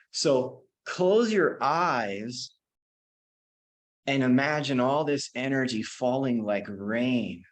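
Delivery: slow, drawn-out meditation guidance; guided meditation. Acted. Teacher guiding the class to put the body to sleep, slow and calm. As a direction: ease them into the exercise, slowly. Leads a body relaxation exercise.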